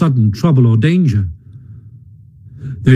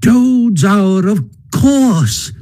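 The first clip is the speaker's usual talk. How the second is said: high-pitched, delighted Mole voice; delighted cry; 8 semitones higher, much slower than usual. A narrator's reading. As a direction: cry it out high, thrilled to have understood. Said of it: "cried the Mole delightedly".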